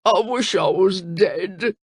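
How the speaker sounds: slow, mild mock moan. Crocodile moans in grief to Cora, slow. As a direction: wallow in it theatrically. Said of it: "he moaned".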